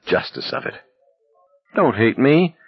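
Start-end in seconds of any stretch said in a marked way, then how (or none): 1.7-2.6 high-pitched pleading whine; whining. A narrator's reading. Barkovich begging Garrity not to hate him, whining. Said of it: "Barkovich was whining".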